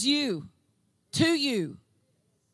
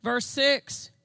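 ordinary delivery

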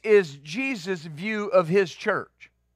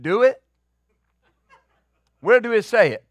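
pastor's way of speaking; 3 semitones higher, 3 dB louder than usual. Retold or imitated. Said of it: "That's how he spoke".

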